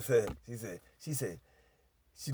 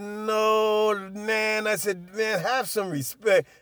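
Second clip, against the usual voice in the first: higher, loud retold objection; indignant protest; 6 semitones higher, 4 dB louder, much slower than usual. Boyfriend demanding respect from girls, acted indignant protest. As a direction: act out a drawn-out, heated protest.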